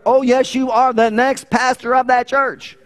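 high, loud, emphatic overruling. Retold or imitated. God overrules the stubborn would-be pastor, insisting loudly and high. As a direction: raise the voice to override all objection. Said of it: "he's gotta go louder and louder".

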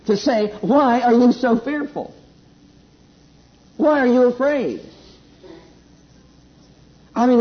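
preaching at full force. Acted. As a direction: preach it out with fire to the congregation.

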